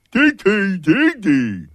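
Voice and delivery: loud, high, drawn-out creepy voice; freaky weird. Pretend. Host signing off with the hashtag, freaky weird. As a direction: squeal each letter slowly like a weirdo.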